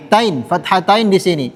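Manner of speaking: normal talking voice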